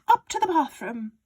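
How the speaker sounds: high, loud, gleeful father; excited Mister Cranky. A narrator's reading. Father urging George on about the medicine, high and loud. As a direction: crow it like a man with a scheme. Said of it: "cried Mister Killycranky".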